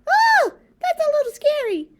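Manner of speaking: high squeaky puppet voice, fast and eager; a chirpy little puppet character. Acted. Puppet Bob's voice.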